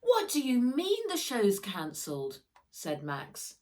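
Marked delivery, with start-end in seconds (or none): 0.0-2.4 high, loud, upset disbelief; shocked disbelief. Acted. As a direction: blurt it out in dismay. Max upset at cancelled show.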